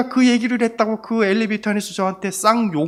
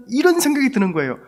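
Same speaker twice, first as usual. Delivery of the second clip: usual speaking voice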